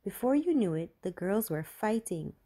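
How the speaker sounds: ordinary delivery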